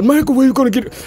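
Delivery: a light mock of a doubter. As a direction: play the sceptic questioning him. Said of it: "Yeah, I know".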